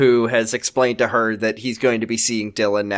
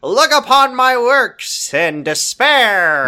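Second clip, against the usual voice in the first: very slow, higher, booming dramatic decree; grand declamation; 10 semitones higher, 3 dB louder, much slower than usual. Acted. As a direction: proclaim it like a doomed king. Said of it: "I went a little Mark Proksch, W C Fields".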